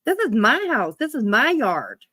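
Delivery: ordinary talk, nothing marked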